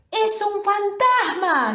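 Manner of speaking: high, loud scream of "¡Es un fantasma!"; terrified cry. Acted. Disciples see a figure on the lake, scream in fear. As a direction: shriek it out in sudden terror. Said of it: "gritaron de miedo".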